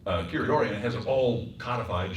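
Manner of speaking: usual speaking voice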